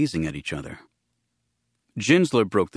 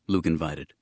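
plain reading voice

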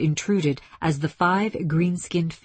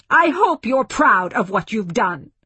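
higher, louder, bitter accusation; angry rebuke; 5 semitones higher, 5 dB louder than usual. A narrator's reading. Woman wanting to hurt the aliens, angrily rebuking. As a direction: throw it at them accusingly.

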